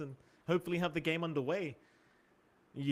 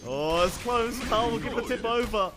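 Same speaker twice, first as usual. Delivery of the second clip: louder, higher rising commentary; excited play-by-play; 6 semitones higher, 5 dB louder than usual. Acted. To viewers and John, calling a near-kill with rising excitement. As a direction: build the hype as the gank nearly lands.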